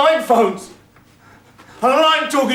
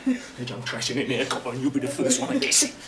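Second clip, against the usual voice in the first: low menace; 10 semitones lower, 10 dB quieter than usual. Acted. Gunman threatens the copper.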